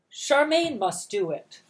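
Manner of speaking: loud, high commanding aunt voice; bossy aunt. Acted. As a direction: lay down the law loudly. Bossy aunt giving orders.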